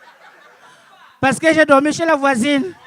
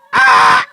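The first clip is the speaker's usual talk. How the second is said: cri de surprise effrayé; 10 dB louder than usual. Acted. Pasteur effrayé par Ebola.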